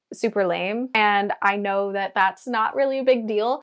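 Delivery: usual speaking voice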